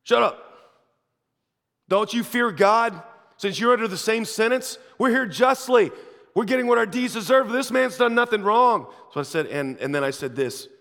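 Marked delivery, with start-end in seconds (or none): 0.0-8.9 loud, high, furious rebuke; rebuking shout. Retold or imitated. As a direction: bark him down, then plead the case. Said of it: "the other criminal rebuked him".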